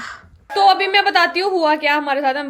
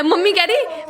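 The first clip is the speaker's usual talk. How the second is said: normal talking voice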